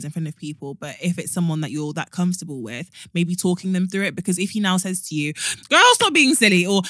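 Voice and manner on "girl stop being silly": higher, louder friendly brush-off; reassuring friend. Retold or imitated. Friend voicing a quick dismissal of nerves. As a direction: wave off her worry like a best friend.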